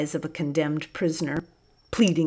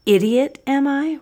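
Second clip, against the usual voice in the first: higher and louder, rising in outrage; roaring; 9 semitones higher, 5 dB louder than usual. A narrator's reading. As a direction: throw the insult back nose to nose. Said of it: "roared Busuku".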